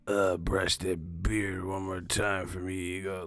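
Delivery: slow, soft leering voice; mock creepy murmur; creepy fan. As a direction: drag it out like a lustful request. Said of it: "They be like".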